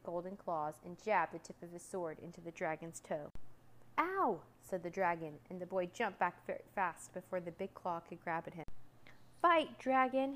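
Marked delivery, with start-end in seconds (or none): 4.0-4.4 high, loud ow; pained yelp. A narrator's reading. Dragon jabbed in the toe, high yelp. 9.4-10.3 loud, high, brave challenge; brave shout. A narrator's reading. Boy challenges the dragon, brave shout. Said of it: "he shouted bravely".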